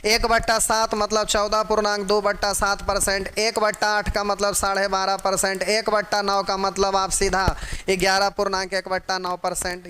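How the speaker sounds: normal talking voice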